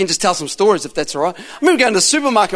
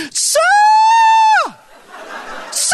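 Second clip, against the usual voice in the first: imitated high, stretched-out shriek; calling out. Cashier calling the preacher across the supermarket, shrieking. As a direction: mimic a woman hailing someone from afar.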